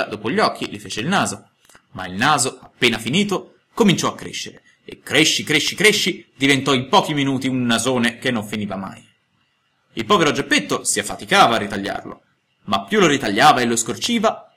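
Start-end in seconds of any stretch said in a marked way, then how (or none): none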